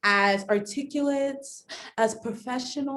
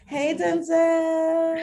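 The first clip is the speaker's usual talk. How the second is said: sing-song greeting; 6 semitones higher, 3 dB louder, much slower than usual. Acted.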